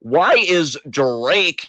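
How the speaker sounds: "Why is Drake" drawn out very slowly; stretched-out "Why is Drake", heavy on every word; exaggerated emphasis. Shown as a demonstration. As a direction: lean hard and long on each word of "Why is Drake". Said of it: "you didn't put enough emphasis on that".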